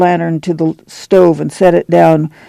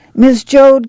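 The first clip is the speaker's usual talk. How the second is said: calling out; 9 semitones higher, 3 dB louder than usual. A narrator's reading. Calling from other end.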